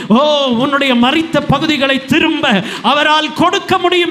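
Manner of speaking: loud, high, ringing sermon climax; impassioned preacher, drawing out the key words; full-force preaching. Real, not acted. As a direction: proclaim the promise to the whole congregation. Preaches promise of restoration at full force.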